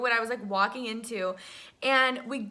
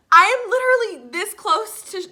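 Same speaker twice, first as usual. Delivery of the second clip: high, loud amazed outburst; starstruck disbelief; 6 semitones higher, 8 dB louder than usual. Retold or imitated. Fan as her idol runs closer, starstruck disbelief. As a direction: exclaim it, overwhelmed by the closeness. Retold shock as idol approaches.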